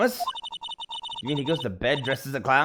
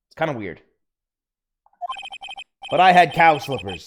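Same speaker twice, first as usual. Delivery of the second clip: usual speaking voice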